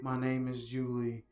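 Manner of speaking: low, soft, dead-calm report of a murder; mock Julie, utterly unbothered; flat calm imitation. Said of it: "that was literally the tone she said it".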